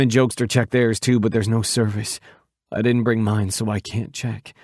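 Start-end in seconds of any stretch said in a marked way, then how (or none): none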